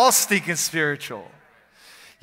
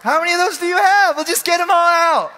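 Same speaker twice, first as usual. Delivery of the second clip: 1 high shouting voice; 12 semitones higher, 9 dB louder than usual. Acted. Shouting at demons, far voice.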